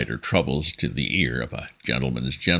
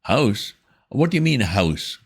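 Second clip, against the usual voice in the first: put-on flustered young gentleman; 9 semitones higher, 4 dB louder than usual. A narrator's reading. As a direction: stammer like a rattled young gent. Wooster voiced, puzzled.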